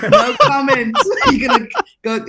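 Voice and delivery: real laughter breaking through the words, high and loud; laughing hard. Real, not acted. Guest laughing off the host's cheeky question. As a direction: burst out laughing while trying to answer.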